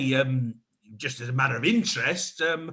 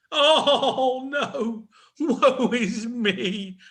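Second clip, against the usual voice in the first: melodramatic wail; 9 semitones higher, 4 dB louder, much slower than usual. Acted. Melodramatic panto lament.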